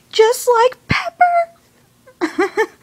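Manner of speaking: high, drawn-out, whimpering cry; mock tearful wail. Fan reminded of Pepper's fall, wailing in mock tears. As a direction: stretch it slowly into a high sobbing whine. Fan's retold upset reaction to scene.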